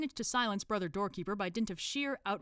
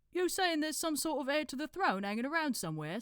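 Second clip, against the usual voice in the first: higher put-on accent for a dim cult member; Brother Watchtower; 10 semitones higher, 3 dB louder than usual. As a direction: interrupt helpfully in a put-on accent. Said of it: "decided to do an accent".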